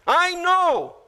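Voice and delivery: high-pitched, mock-certain declaration; know-it-all insistence. Martha answering Jesus, high know-it-all insistence. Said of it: "Martha twice".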